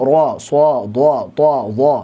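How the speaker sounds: "ro, so, do" with the lips pushed out into a pout; "so, do" pouted like a dhammah; wrong pouted O. Shown as a demonstration. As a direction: demo the mistake, lips rounded forward. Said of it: "ini salah".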